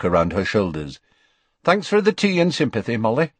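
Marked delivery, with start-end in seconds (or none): 1.6-3.3 quick, hurried thanks; hasty goodbye. A narrator's reading. Tonks thanks Molly as she hurries to leave. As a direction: rush out the thanks.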